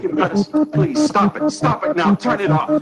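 high, frantic begging; desperate pleading. Acted. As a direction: beg them to stop, panicked.

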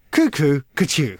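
slow, lightly voiced gardener doing nonsense lyrics; Peter Cundall. Hosts demand the Walrus refrain, slow gardener impression. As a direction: draw out the refrain in a mild impression. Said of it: "And now do Peter Cundall".

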